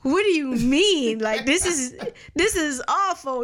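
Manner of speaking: high, pleading disbelief with mock tears; retelling her own shock at the altar, high and pleading; distraught younger self. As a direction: plead through imitated tears, pitched well up. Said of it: "looked at her like".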